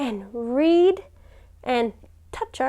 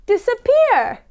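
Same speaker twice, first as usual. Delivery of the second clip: call-and-response; 10 semitones higher, 5 dB louder than usual. Shown as a demonstration.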